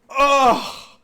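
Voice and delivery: loud, high cry of impact; pained cry. Acted. Game master voices fighter struck under the chin, pained cry.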